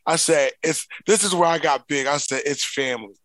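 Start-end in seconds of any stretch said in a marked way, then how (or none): none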